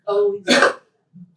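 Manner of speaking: loud high call to always document; emphatic plea. Real, not acted. Speaker pressing the audience to record elders, loud emphatic plea. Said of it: "urging the audience".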